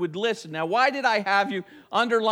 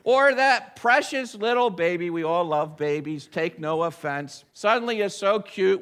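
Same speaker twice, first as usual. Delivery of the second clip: ordinary talk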